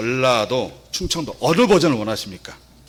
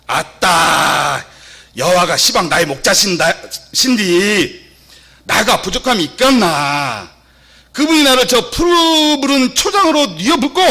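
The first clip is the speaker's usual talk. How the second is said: Jeolla dialect; 9 semitones higher, 6 dB louder than usual. Shown as a demonstration. Reciting Psalm 23 for the congregation, hammed-up Jeolla dialect. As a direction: belt out broad Jeolla dialect, stretching the exclamations. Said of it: "전라도 버전 한 번만 해드리겠습니다".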